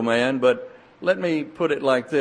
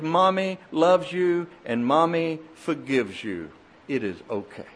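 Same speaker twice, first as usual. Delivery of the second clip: loving reassurance. Retold or imitated. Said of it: "the mother's love kicks in".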